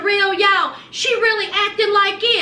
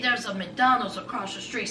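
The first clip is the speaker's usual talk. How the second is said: mocking Andre; 8 semitones lower, 7 dB quieter than usual. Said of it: "And Andre said".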